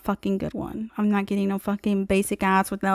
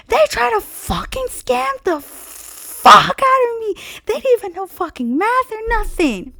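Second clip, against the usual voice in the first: much higher, louder, slow venting rant; high furious rant; 12 semitones higher, 5 dB louder than usual. Retold or imitated. Calling her sisters to rant about the dealer, high and furious. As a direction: vent to the sisters at a high pitch. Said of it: "And I was like".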